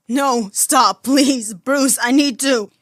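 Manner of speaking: high, loud tearful begging; breaking, desperate cry; sobbing plea. Acted. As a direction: plead through sobs. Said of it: "cut off by another hitching sob".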